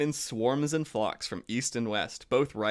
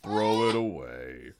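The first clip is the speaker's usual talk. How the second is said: slow Eeyore; 3 semitones lower, slower than usual. Acted. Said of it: "such a good Eeyore".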